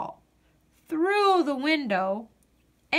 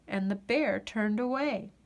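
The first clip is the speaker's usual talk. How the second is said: ordinary delivery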